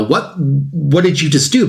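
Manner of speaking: usual speaking voice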